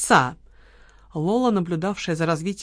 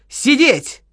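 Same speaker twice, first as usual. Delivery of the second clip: higher, louder barked «Сидеть»; barked order; 6 semitones higher, 5 dB louder than usual. A narrator's reading. Gunwoman orders the table to stay seated, barked. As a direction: snap it like a command to a dog. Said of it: "рявкнула она".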